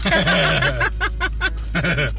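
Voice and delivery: giggling through the words; laughing. Real, not acted. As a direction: laugh at the messy hair. Laughing at her wet hair.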